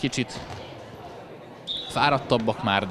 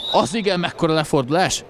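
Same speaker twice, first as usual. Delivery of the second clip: excited commentary; 7 semitones higher than usual. Real, not acted. Live handball goal commentary.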